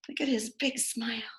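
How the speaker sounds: teacher whispering to preschoolers about the picture. Real, not acted. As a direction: lean in and whisper it. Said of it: "aside to children".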